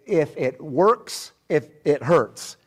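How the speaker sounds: deliberate, spaced-out delivery; slow quoted saying. Retold or imitated. Preacher quotes his dad about his own body, slow and deliberate. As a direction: lay out each half slowly. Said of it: "he'll say about his own body".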